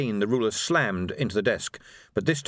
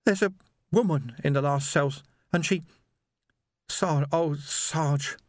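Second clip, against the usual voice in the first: higher voice, halting and broken off; trembling distress; 6 semitones higher than usual. A narrator's reading. Young constable reports what he found in the cell, distressed. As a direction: struggle to get it out, stopping short. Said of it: "He was trembling".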